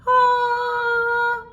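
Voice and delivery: long, high, held "ahh"; blissful "ah". Retold or imitated. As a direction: mimic bliss with one sustained high note. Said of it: "it's like".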